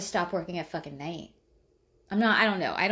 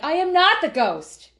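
higher, louder mock protest; indignant retort; 9 semitones higher, 7 dB louder than usual. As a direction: insist hard, pushing back with a loud indignant voice. Acted indignant reply.

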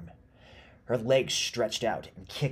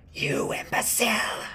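high, loud screech of rage; screaming witch; 7 dB louder than usual. A narrator's reading. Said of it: "she screamed".

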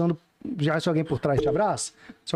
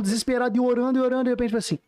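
normal talking voice

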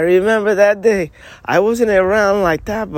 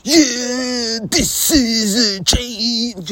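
stretched-out wrestler impression, pitched up; Randy Macho Man Savage; 7 semitones higher, much slower than usual. Mimicking how Annie talked earlier, drawn-out wrestler impression. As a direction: drag every word out in a high wrestler voice. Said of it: "talking like a Randy macho man savvy".

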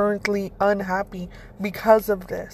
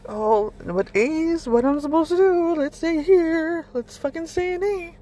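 high, pleading mock voice, rising into the squeak; mock whiny; 8 semitones higher, 4 dB louder than usual. Mocking a person refusing to change: high whiny pleading. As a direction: imitate someone clinging to comfort. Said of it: "and you're like".

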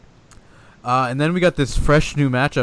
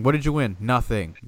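ordinary talk, nothing marked

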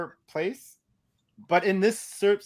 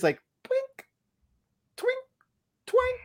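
high-pitched metallic twink noises; tiny pinging twinks, squeaked out; imitated twink; slower than usual. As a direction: mimic the steel wire snapping, way up high. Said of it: "the only thing I hear is".

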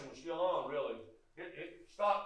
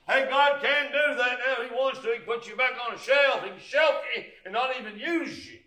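full-force preaching; 10 semitones higher, 13 dB louder than usual. Acted. Preacher thundering at his congregation about God's shelf.

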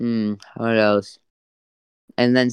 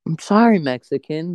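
mock apology; 5 semitones higher, 5 dB louder than usual. Mocks friend's retold apology.

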